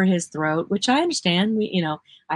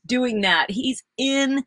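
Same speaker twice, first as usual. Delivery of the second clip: normal talking voice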